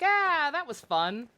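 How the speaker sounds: high, slow delighted ah; mock delighted. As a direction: gush over it, slowly. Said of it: "I was like".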